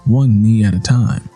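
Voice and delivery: ordinary delivery, nothing marked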